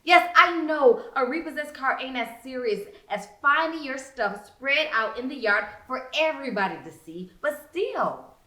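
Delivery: plain reading voice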